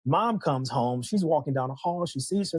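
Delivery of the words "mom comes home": ordinary delivery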